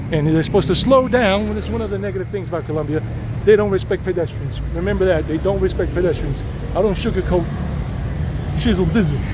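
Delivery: usual speaking voice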